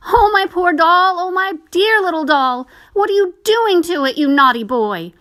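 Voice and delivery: a distraught little sister cries out; crying out. A narrator's reading. Sister cries out at her brother, very high and loud. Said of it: "crying out".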